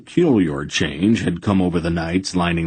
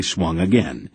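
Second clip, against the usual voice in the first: usual speaking voice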